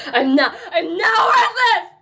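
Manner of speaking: high, loud cry with a laugh in it; defiant shout. Acted. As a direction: yell it out, half laughing half crying.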